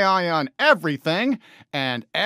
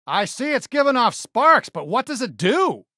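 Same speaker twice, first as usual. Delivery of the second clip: usual speaking voice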